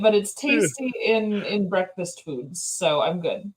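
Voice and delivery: normal talking voice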